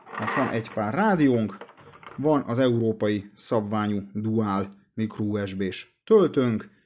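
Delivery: normal talking voice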